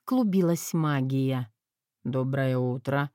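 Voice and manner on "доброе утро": low, hoarse morning voice. Acted. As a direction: rasp it out, just woken. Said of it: "произнёс он хрипло".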